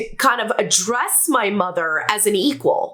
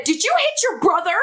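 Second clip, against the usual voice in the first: higher, fast, sharp angry question; livid mother; 8 semitones higher, faster than usual. Retold or imitated. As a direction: confront the kid like a furious mom. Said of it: "she was livid".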